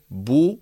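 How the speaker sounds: "بو" with b released into a u; voweled consonant. Shown as a demonstration. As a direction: open from b into rounded u on "بو". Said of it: "كذلك". (نطق "بو" باء مضمومة)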